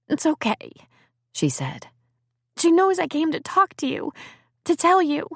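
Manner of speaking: put-on high, reassuring young girl voice; a girl telling him her aunt knows; young girl Liz.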